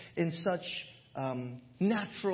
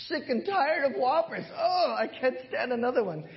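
higher, louder fed-up whine; mock fed-up dad; 8 semitones higher, 4 dB louder than usual. Dad telling his son he is fed up with Whoppers, mock whine.

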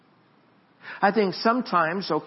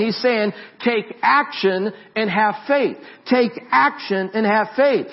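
high, loud pulpit preaching; preaching at full force; 6 semitones higher, 5 dB louder than usual. Acted. Pastor preaching the pool of Bethesda to his church, loud and high. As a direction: preach it out big to the congregation.

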